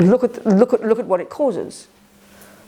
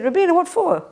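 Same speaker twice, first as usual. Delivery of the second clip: higher-pitched, lightly incredulous retort; casual, amused reply; 9 semitones higher than usual. Retold or imitated. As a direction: imitate someone casually laughing off a question. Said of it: "he just laughed at me casually".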